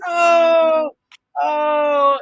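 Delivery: high, loud wailing oh; hammed-up wail; mock cry of shock. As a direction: overplay the shock.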